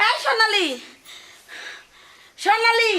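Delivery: high-pitched urgent call; agitated calling. Acted. Mother calls her other daughter, agitated calling. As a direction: call the name out high and insistent. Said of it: "তুমি এত উত্তেজনা হইছো কে মা?".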